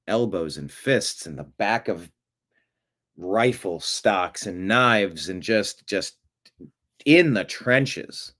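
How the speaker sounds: slow, heavy, dramatic telling. Acted. Host describing trench combat to viewers, slow and grave. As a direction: draw out each brutal image. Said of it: "slow dramatic trench description".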